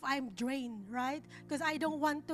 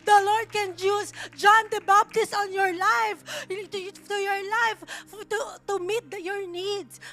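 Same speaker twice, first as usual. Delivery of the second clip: louder and higher, driving each line home; rising sermon climax, louder and louder; full-force preaching; 3 semitones higher, 9 dB louder than usual. Acted. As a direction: preach it out to the whole congregation.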